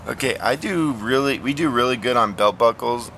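normal talking voice

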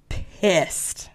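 whispered for comic emphasis; emphatic whisper. Real, not acted. Telling co-host how Houdini felt, emphatic whisper. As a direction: drop to a voiceless whisper. Punchline on Houdini's anger, voiceless.

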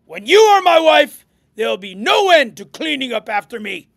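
loud boorish pig, opening high; snorting pig. A narrator's reading. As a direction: crow it like an overbearing pig. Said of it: "he snorted".

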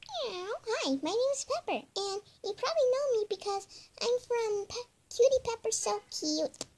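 high, fast cute kitty voice; squeaky kitten voice. Pretend. Child voicing a kitten toy, high and squeaky.

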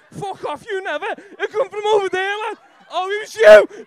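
high, loud laughing accusation; high excited shout; excited accusing. Real, not acted. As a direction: call out the whistler in the crowd. Accusing someone who whistled.

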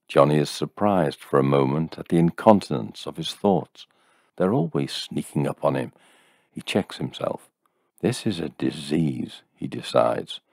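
plain reading voice

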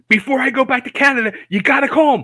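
high, loud, rushed nagging; imitating hollering friend. Visiting friend pestering him every day to call, retold hollering. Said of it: "is hollering at me every day".

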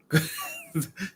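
a high teasing giggle. Retold or imitated. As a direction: giggle like the spirit. Spirit laughing.

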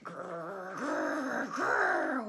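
deep rumbling growl, loud; scary thing growl. A narrator's reading. The big scary thing growling at the hunter, deep and loud. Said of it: "went the big, scary thing".

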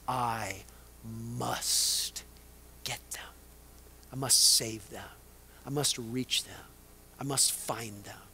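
low, soft, insistent quote; hushed resolve. Retold or imitated. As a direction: press each "must" quietly, with pauses between phrases. Preacher voices Jesus' resolve, hushed.

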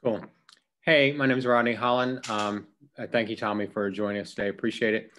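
ordinary talk

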